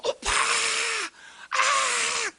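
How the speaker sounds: high-pitched mock fangirl squeal; screaming teen fan. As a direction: imitate teenagers screaming for an idol. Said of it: "십 대들, 이십 대들 어떻게 해요?". (아이돌 팬의 높은 비명 흉내)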